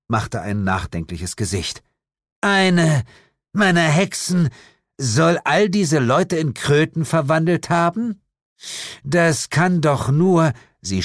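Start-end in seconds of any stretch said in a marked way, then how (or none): 2.4-10.5 high, louder voice of disbelief; incredulous. A narrator's reading. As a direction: question it in indignant disbelief. Lilith incredulous at the accusation. (ungläubig und empört)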